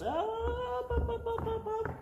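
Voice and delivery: high sung-out up up up; mock Jesus stopping him. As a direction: call him back in a high singsong. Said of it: "and Jesus goes".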